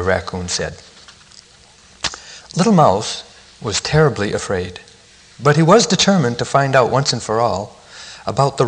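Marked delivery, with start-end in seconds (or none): none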